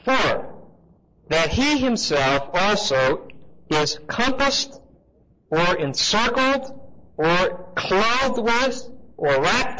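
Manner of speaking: slow, lifted reading voice, word by word; scripture reading. Acted. Teacher reading the verse aloud to his class, slow and solemn. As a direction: read the verse out slowly and deliberately.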